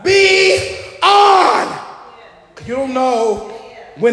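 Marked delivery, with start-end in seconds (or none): 0.0-1.8 short high shout; shouted call. Real, not acted. Preacher calling to the church, high shout. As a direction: punch it out to the room.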